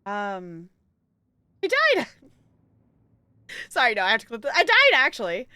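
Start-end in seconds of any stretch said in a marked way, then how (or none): 1.6-2.1 playful shout. Pretend. Pranks Stella as a ghost. 4.5-5.5 playful loud claim. Pretend. Keeps up the ghost prank.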